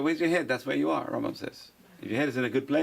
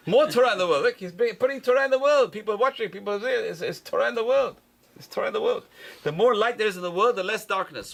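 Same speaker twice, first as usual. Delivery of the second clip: excited; 8 semitones higher, 4 dB louder than usual. Real, not acted. Excited praise of Torah online.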